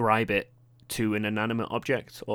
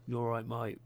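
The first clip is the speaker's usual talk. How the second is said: rough London chav voice, quiet and slightly raised; London accent attempt; 3 semitones higher, 7 dB quieter than usual. Shown as a demonstration. Podcaster showing how locals should sound, tentative London accent. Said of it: "that sounds way too London".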